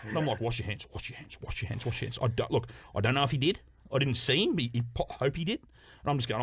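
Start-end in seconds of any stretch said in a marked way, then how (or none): 0.4-2.2 urgent hushed plea. Retold or imitated. Urging boss after toilet incident.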